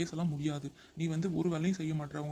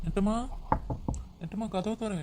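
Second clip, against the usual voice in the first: calling through the door, higher; 5 semitones higher than usual. Acted. Husband calling wife to open door, calling out. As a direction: call her to open the door.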